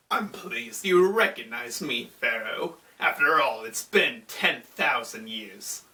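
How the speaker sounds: low theatrical villain voice; Anubis. Retold or imitated. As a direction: greet an old foe with low, mock menace. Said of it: "And Anubis is like".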